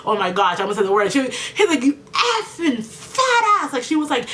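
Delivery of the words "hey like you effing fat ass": high, drawn-out mock tirade; retold angry outburst. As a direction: imitate her outburst, stretching the insult high. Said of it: "hitting the window and saying".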